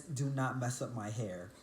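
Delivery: usual speaking voice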